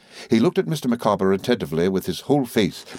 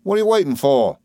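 higher-pitched, irked demand; impatient employer; 7 semitones higher, 4 dB louder than usual. A narrator's reading. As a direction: challenge a disobedient underling. Employer challenges a stubborn clerk.

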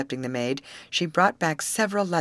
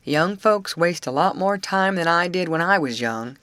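a lightly voiced grumpy old man, louder and disdainful; an old uncle's disapproving grumble, lightly voiced; sniffy; 3 semitones higher, 6 dB louder than usual. A narrator's reading. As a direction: sniff at the young folks' ways. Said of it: "said Mister Ford Senior with a sniff".

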